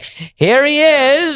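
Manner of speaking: high, very drawn-out mock announcer; stage introduction. Comic mocking how his friend brings him up, drawn-out emcee. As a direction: stretch it out like a hammed-up emcee. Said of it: "and he's like".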